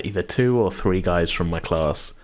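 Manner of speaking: usual speaking voice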